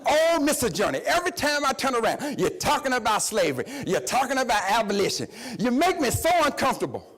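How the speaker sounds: acting out someone fussing. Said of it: "this man got to fussing".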